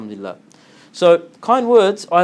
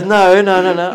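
loud, higher, hurried mock refusal; alarmed refusal; 4 semitones higher, 8 dB louder than usual. Woman refusing to repeat the profession of faith, mock alarmed. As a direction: retell her emphatic, raised-voice refusal.

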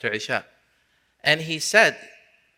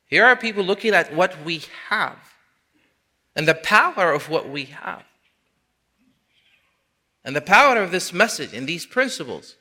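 raised, emphatic sermon voice; impassioned preaching; 6 semitones higher, 5 dB louder than usual. Acted. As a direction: press each point home, high and loud. Preaches with force.

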